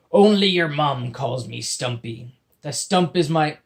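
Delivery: slow, loud pirate growl; a grumpy pirate snapping at his captor; gruff pirate. Acted. Pirate character voice in story.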